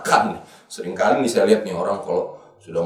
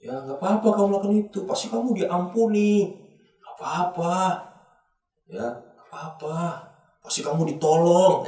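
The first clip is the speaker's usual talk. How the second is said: high, coaxing tempter's voice; imitated tempter, raised pitch and lilting reassurance; tempting world; 7 semitones higher than usual. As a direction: coax them lightly into sin. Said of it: "dunia seringkali menawarkan hal demikian".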